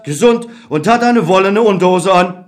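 normal talking voice

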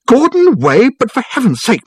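high, loud shocked cry; stunned disbelief. Acted. Michael shocked his mother sold to Gordon Way. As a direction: gasp the name out in outrage.

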